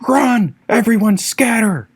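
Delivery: a high, urgent shout to flee; Levi shouting at the group to scatter; panicked yell. A narrator's reading. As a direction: yell the order to run. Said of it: "yelled Levi".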